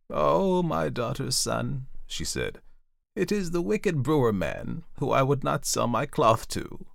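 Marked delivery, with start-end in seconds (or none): 0.1-1.9 high, drawn-out lament; distressed old aunt pleading to her nephew; tearful. A narrator's reading. Auntie confides in her nephew, upset and tearful. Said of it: "and she began to cry". 3.1-6.9 high, distressed voice close to crying; tearful. A narrator's reading. Auntie names the man who threatens her, tearful. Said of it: "and she began to cry".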